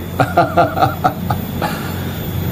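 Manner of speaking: long, soft cackling chuckle; teasing laugh. Real, not acted. Commentator hinting at a secret, teasing laugh. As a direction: laugh knowingly after the insinuation.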